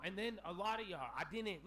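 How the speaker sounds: normal talking voice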